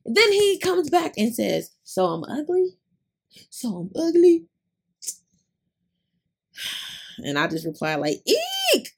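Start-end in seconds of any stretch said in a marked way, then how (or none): 1.8-2.8 higher-pitched mock incredulous question. Retells his hurt reply, first take. 3.5-4.4 hammed-up, high and loud mock disbelief; louder incredulous question. Repeats his reply, more exaggerated. 8.3-8.9 very high-pitched cringing "eek"; mock squeal. Acts out her typed awkward reply.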